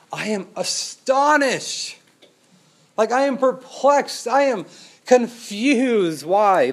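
Paul rebuking the Galatians in dismay; Paul's astonished outrage. Acted. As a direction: pour out shocked disbelief.

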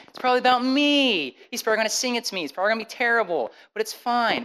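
high-pitched imitation of an excited girlfriend; giddy guessing. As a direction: lightly act out happy anticipation. Girlfriend happily guessing what note is.

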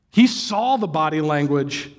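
ordinary talk

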